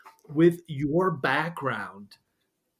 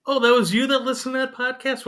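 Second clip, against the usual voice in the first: surprised; 11 semitones higher, 4 dB louder than usual. Real, not acted. Host learns the guest heard his podcast.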